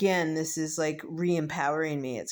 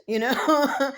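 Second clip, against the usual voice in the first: higher, louder laugh; 8 semitones higher, 7 dB louder than usual. Real, not acted. Vlogger laughs at her own medicinal joke, higher and louder. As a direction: laugh it off big.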